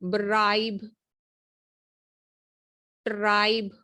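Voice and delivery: ब्राइब and ट्राइब with a firm, clear R; stressed R. Shown as a demonstration. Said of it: "जोर दे के जो है ना".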